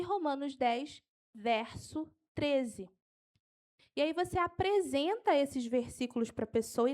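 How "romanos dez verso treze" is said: slow dictation, drawn out at the end; unhurried, measured verse reference; slow for note-taking. Shown as a demonstration. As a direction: space out the reference for writers. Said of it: "Eu vou falar devagar".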